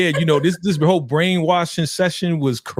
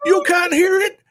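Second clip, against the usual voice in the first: very high, hammed-up outraged voice; mock disbelief, wailing way up high; Elder Mother; 3 dB louder than usual. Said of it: "Oh, the mama".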